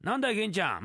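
usual speaking voice

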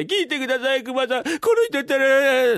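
very high, wailing wife voice; tearful wail. Acted. Crying wife appealing to Kuma about her husband, wailing. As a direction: wail it out, drawing the last words long and loud. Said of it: "何泣いてんだ".

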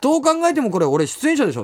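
a lightly acted come-on-now; insisting to the guard he is a performer, exasperated; exasperated protest. Arguing with security guard.

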